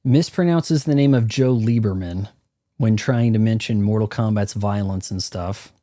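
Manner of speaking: normal talking voice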